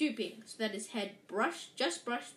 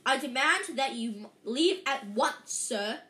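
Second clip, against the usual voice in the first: louder, slow indignant demand; blustering demand; 2 semitones higher, 6 dB louder than usual. A narrator's reading. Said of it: "he said".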